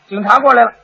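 ordinary talk, nothing marked

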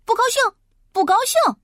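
high, loud stubborn yell; a contrary boy shouting his refusal. A narrator's reading. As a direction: holler it out in defiance. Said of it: "还大叫大嚷".

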